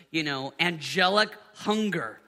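ordinary talk

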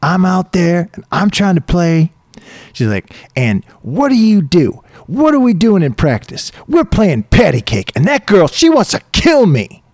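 retelling a furious outburst at a practice partner; furious rant. Retold or imitated. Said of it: "she's furious at him, furious".

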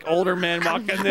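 ordinary delivery

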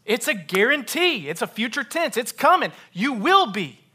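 high, loud bursts of insistence; full-force preaching. Real, not acted. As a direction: hammer out each short phrase as a certainty.

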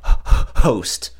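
ordinary talk

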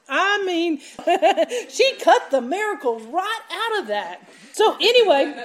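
loud and high, laughing through the words; can hardly get it out for laughing. Real, not acted. Preacher cracking up telling the congregation the story.

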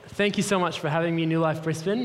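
usual speaking voice

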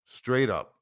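usual speaking voice

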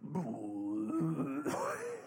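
low, soft gurgling noise; imitated retching rumble. Father hearing his son about to throw up, imitated gurgle. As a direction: imitate vomit rising from the belly. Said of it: "all of a sudden I hear this".

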